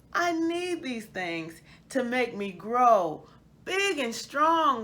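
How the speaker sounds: put-on lively storybook narration for children; story reading. A narrator's reading. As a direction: read it like a bedtime picture book to kids.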